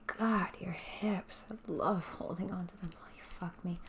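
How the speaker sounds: ordinary talk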